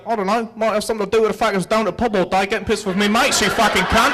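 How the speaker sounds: put-on a drunk Englishman mouthing off to a cop in an English accent. English dad to the cop, fast and rowdy English accent. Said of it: "drunk English dad voiced".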